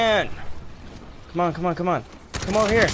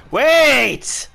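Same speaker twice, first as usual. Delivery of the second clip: sudden shout; 2 semitones higher, 7 dB louder than usual. Real, not acted. Sudden alarm mid-game.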